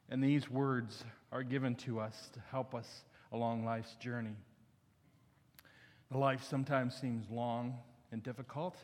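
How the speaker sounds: low-key, softening public prayer. Real, not acted. Pastor leads the congregation to God before the reading, prayerful.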